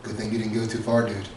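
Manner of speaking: low, hushed inner voice; soft, deep-pitched voice of the body; body talking. Acted. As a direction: murmur a low warning from the body. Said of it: "the body was like".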